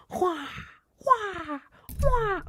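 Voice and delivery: high, falling eagle cries, imitated; repeated eagle screeches. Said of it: "That's the eagle".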